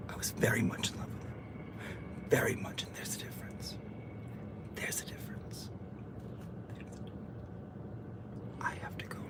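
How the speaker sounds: breathy secret whisper, barely audible. Pretend. Streamer whispering a secret crush to her chat. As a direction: lean into the mic and whisper a confession.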